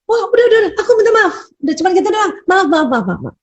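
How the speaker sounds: high, hurried mock husband apology; imitating a husband apologising just to end it, pitched high; impatient husband. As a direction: brush it off like an impatient dad. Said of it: "Ada, ada bapak-bapak".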